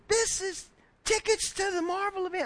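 put-on high, astonished little boy's voice; a small boy who can't believe his luck; amazed child. Boy amazed at Marvel tickets, high astonished child voice.

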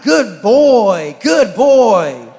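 high, loud doggy praise; sing-song good boy to the pet; praising the dog. Retold or imitated. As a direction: gush over the dog. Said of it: "I'm like".

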